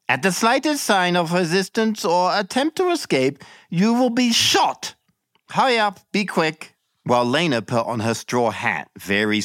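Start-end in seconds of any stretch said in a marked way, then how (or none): 0.1-6.7 much higher, curt commanding voice; little man giving orders. Acted. Little man on the stairs ordering the arrest, high curt commands. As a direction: bark it out like a petty official. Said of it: "gave orders".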